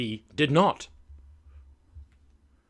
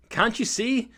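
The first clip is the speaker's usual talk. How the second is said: high, urgent plea in rhyme; hammed-up storybook panic; alarmed cry; 8 semitones higher, 7 dB louder than usual. A narrator's reading. Andy warns Terry the bee is after him, panicked cry. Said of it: "I cried".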